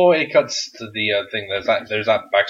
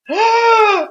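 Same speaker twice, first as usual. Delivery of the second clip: high, loud gasping shriek; a sudden thrilled gasp; excited gasp; 8 dB louder than usual. Retold or imitated. Two fans reacting as the music hits, a gasp. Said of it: "we probably both went".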